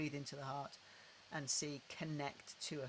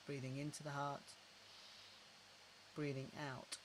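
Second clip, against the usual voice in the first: guided breathing cue; 6 dB quieter than usual. Shown as a demonstration.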